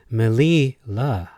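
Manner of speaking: "Melila" stretched as m'LEEE... la; slow, deliberate syllables; said slowly. Shown as a demonstration. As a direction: draw out "li" in "Melila", then a separate "la". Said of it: "at which one can pronounce this word".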